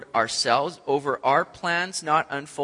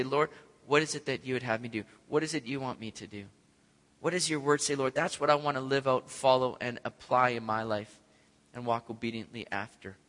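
softer, lower humble asking; a soft, low, humble request; humble prayer; 5 semitones lower, 6 dB quieter than usual. Acted. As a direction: pray it humbly.

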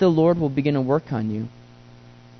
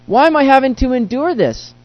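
high, whiny complaint to the Lord; exasperated prayer; 8 semitones higher, 3 dB louder than usual. Retold or imitated. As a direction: cry out like a man boxed in. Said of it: "I feel boxed in".

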